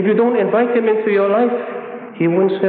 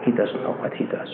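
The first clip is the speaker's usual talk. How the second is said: low, hushed, tender prayer; gentle forgiveness; 7 semitones lower, 9 dB quieter than usual. Acted. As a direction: let it sink softly, pleading for mercy. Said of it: "look gently at the person".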